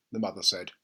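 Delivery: ordinary delivery, nothing marked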